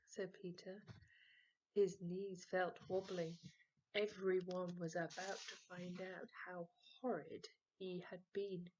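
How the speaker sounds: ordinary delivery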